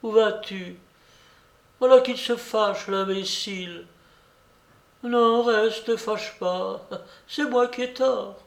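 put-on high-pitched old lady, scolding then conciliatory; coaxing babouchka. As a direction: coax them back in a high old lady's voice. (vieille dame conciliante, voix aiguë)

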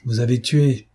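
ordinary delivery

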